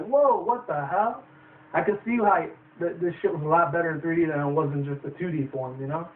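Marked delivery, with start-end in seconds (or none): 0.1-1.2 mock shock. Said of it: "It was like".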